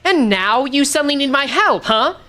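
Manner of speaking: put-on defiant girl. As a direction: throw the words back at him. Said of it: "maybe I'm just naturally leaning into it".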